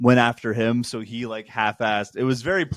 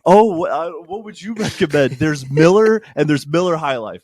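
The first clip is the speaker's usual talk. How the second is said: higher, louder mock bartender rant; grumpy bartender; 8 semitones higher, 3 dB louder than usual. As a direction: snap at him like a fed-up bartender. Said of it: "It's like".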